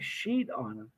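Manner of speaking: low, hushed musing to himself; retold dawning realisation, under the voice; puzzled thinking aloud. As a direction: drop low and quiet as it sinks in. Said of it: "the gears are turning in my head".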